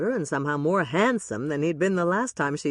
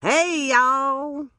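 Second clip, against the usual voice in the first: loud, high hammed-up Southern accent; brash bad Southern accent greeting; 9 semitones higher, 5 dB louder than usual. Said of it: "a voice with a really bad Southern accent".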